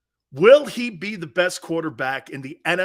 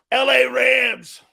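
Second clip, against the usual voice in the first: drawn-out call-out; 6 semitones higher, 8 dB louder, much slower than usual. Real, not acted. Calling out the rival team.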